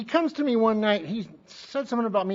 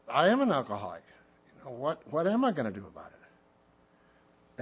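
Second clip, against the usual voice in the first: sober realisation; 5 semitones lower, 5 dB quieter than usual. Retold or imitated. Sobering realisation retold after the rant.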